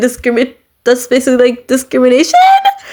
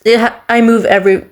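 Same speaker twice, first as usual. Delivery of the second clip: ordinary talk, nothing marked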